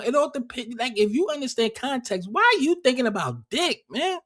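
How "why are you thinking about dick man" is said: exasperated. Real, not acted. Exasperated rhetorical question.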